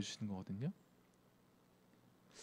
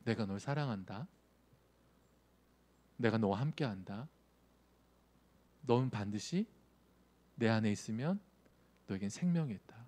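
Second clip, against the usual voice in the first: soft, lowered, reassuring words with pauses; quiet, calm promise; Jesus speaking tenderly; 3 semitones lower, 5 dB quieter than usual. Acted. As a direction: gently comfort a beloved child. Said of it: "예수님은 항상 우리에게 말씀하세요".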